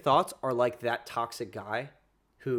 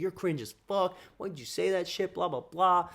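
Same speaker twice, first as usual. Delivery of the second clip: mocking the triggered guy, pitched up; hammed-up angry whine, higher; furious guy imitated; 7 semitones higher than usual. Imitating the furious boyfriend insulting him, mock whiny rant. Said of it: "He's like".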